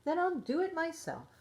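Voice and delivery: little hen decides on her own; determined hen. A narrator's reading. Hen answers the lazy barnyard, in high firm voice. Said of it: "the little red hen said".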